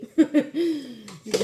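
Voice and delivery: brief embarrassed laugh; self-conscious laugh. Real, not acted. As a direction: laugh off your own bad accent. Laughs at own English accent.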